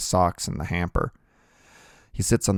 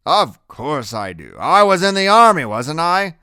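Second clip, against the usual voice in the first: loud, rising in pitch; grandfather's heated retort; indignant arguing; 8 semitones higher, 8 dB louder than usual. A narrator's reading. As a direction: push back indignantly. Grandfather argues back.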